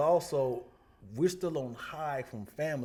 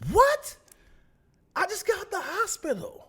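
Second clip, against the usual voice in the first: much higher, louder startled cry; shocked disbelief; 9 dB louder than usual. Retold or imitated. Miss Calhoun hearing of the death, high shocked imitation. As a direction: gasp it out in disbelief.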